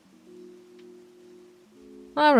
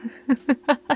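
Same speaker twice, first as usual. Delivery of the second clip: light, higher-pitched giggle; amused giggle; 5 semitones higher than usual. Acted. Alpha hearing an apology, amused giggle. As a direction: giggle at the pup's expense.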